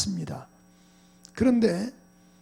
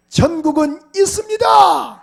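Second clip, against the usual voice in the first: "있습니다" shouted high and slow; shouted proclamation; 9 semitones higher, 12 dB louder, slower than usual. Shown as a demonstration. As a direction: declare "천국은 있습니다" loudly to the congregation. (회중에게 "있습니다"를 크고 느리게 외침)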